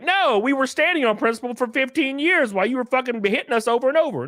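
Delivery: ordinary delivery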